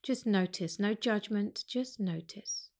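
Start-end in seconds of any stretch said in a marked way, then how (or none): 0.8-1.6 calm yoga teacher guiding a breathing practice; guided meditation. Acted. Leads a seated breath meditation. 1.7-2.7 just notice, softer and slower, letting it fade; slow fading meditation cue; softer, slower repeat. Acted.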